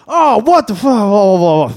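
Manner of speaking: loud, high mock outrage; imitated uproar, starting high and falling into blahs; Jersey Shore outburst. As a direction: blurt it out like a rowdy Jersey Shore crowd. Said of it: "immediately the Jersey Shore comes out".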